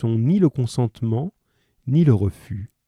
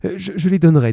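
higher, louder stammered agreement; flustered mother; 9 semitones higher, 6 dB louder, much faster than usual. A narrator's reading. Mother accepts the innkeepers' price, flustered and eager. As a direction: stumble into saying yes.

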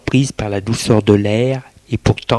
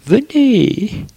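lightly voiced woman, higher; Hélène's voice; 13 semitones higher than usual. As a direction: invite her in, pitched up. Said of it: "dit Hélène".

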